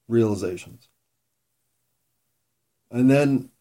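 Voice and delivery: usual speaking voice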